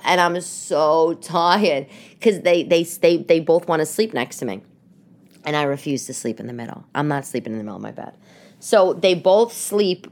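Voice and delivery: usual speaking voice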